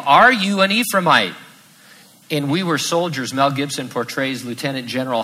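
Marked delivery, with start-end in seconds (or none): 0.0-1.3 1 challenging question. Retold or imitated. Guards challenging at the river.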